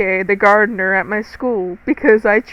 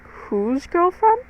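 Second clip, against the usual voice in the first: drawn-out, high-pitched retold bafflement; puzzled question, stretched and high; baffled reaction; 8 semitones higher, slower than usual. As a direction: replay your confusion, pitched way up and slow. Retelling her baffled reaction to boy.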